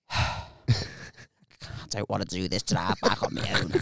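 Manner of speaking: low, soft, slow grumble; weary mock moan. Friend dreading the drive back alone, low slow moan. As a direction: sigh it out slowly, down low.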